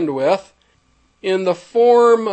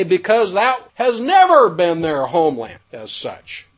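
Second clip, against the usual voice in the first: usual speaking voice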